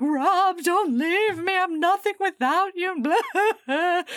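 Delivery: high, hammed-up tearful wail; mock sobbing. Woman begs Rob not to leave her, mock sobbing. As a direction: wail it like an over-the-top meltdown. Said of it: "sobbing and being like".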